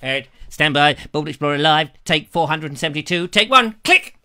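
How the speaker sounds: playful clapper loader announcing the take; clapperboard operator. Pretend. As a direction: call the take briskly and end on a sharp click. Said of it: "He's got the clapperboard out".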